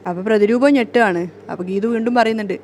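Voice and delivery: ordinary delivery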